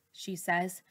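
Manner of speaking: usual speaking voice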